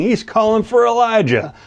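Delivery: mock him the way the jeering crowd did; sarcastic jeer, voice jumping high; cruel mocking. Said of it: "and cruelly they're saying".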